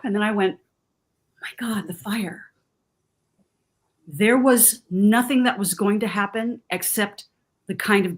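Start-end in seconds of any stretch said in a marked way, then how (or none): 1.4-2.5 whispered under the breath; a retold whisper of dread; hushed gasp. Retold panicked thought at night.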